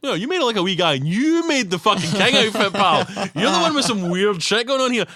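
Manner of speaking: loud, high mock telling-off; mock scolding. Telling Pretorius off about the king outfit, loud and mocking. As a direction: scold him like a mate calling him out. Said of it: "and it's like".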